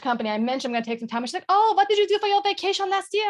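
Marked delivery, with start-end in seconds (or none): 1.5-3.3 high, bright mock French accent, a bit louder; imitating a French colleague with a bad French accent. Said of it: "my terrible, terrible French accent".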